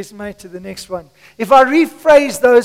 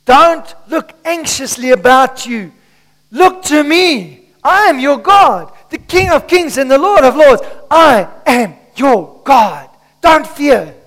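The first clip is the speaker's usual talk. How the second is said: louder, higher, slowed preaching with a catch in the voice; impassioned preaching; 3 semitones higher, 5 dB louder than usual. Acted. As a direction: plead with the church to trust.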